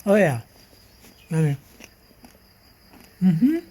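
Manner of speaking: talking through a mouthful of bacon; chewing while talking; mouth full. Real, not acted. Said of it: "Talking with my mouth full".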